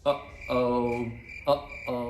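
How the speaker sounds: "Uh-oh" with the breath cut between the two syllables; low, clear break in the middle of "Uh-oh"; glottal stop demo. Shown as a demonstration. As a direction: say "uh-oh" with a catch in the throat. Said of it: "essa pausa que a gente dá".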